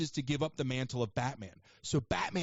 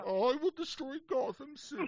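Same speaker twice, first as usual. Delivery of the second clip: high mournful whine, much higher; sad clown Bane; 11 semitones higher than usual. Retold or imitated. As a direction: whimper like a sad clown villain. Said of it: "He sounded like a sad clown".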